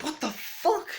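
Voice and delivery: high, squeaky stunned exclamation; shocked disbelief. Retold or imitated. Runner to himself, stunned by the smiling neighbour, high disbelief. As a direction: blurt it out in total disbelief.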